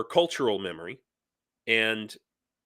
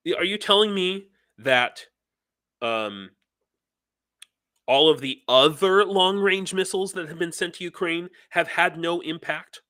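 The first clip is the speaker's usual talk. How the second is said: real anger; 5 semitones higher than usual. Real, not acted. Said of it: "I'm just too angry".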